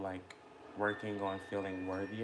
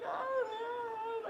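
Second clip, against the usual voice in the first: very high whimpering mock sob; mock crying. Acting out her crying moment, very high mock sob. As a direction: sob the words as a hammed-up cry. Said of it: "like, crying and stuff".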